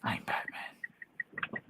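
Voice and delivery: slow whispered gravel Batman. Pretend. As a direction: breathe it like Batman. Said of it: "host echoes Batman voice".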